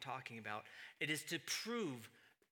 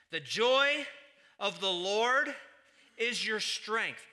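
loud emphatic quote; 4 semitones higher, 9 dB louder, slower than usual. Acted. Quoting verse emphatically.

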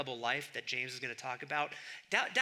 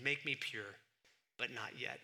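softer, dry repeat with a wink; quiet ironic echo; 5 semitones lower, 6 dB quieter than usual. Retold or imitated. Preacher repeating Augustine's prayer to mock it, quiet ironic echo. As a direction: murmur it back, tongue in cheek. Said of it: "I love that prayer".

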